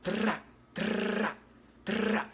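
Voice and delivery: imitating the gunfire heard in the casino; gunshot imitation. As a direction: mouth the three bursts of gunfire. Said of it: "They were like".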